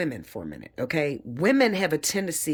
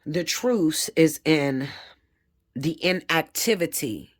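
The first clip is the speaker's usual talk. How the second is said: slow deliberate emphasis; slower than usual. Real, not acted. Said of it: "slow deliberate teaching".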